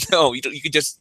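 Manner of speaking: normal talking voice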